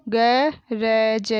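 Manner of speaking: गरज drawn out as ga, ra, ja; very slow गरज, each letter held apart; spelled out. Shown as a demonstration. Teacher sounding out गरज for pupils, syllable by syllable. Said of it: "मैंने इस तरीके से पढ़ा".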